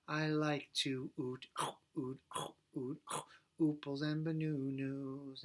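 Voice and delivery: "eat" sung as "oot", "bananas" as "banunus"; U vowel swap. Shown as a demonstration. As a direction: sing every vowel as OO. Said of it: "the U sound".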